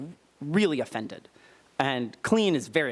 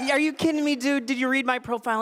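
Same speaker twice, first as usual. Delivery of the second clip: incredulous retort; 9 semitones higher than usual. Retold or imitated. Incredulous retort to his date.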